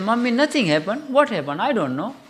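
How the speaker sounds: put-on child reassuring his mother; small child. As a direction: play the little boy acting innocent. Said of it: "Children, small children".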